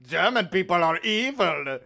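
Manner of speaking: a hint of a menacing German accent; lightly accented evil German; mock German villain. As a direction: ham up a movie German villain. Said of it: "I love the German stereotype of".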